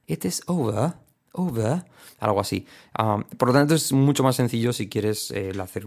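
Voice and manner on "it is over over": higher, lilting British "over" without R; demonstrative British accent. Shown as a demonstration. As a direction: drop the R in a British lilt. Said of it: "Una R británica".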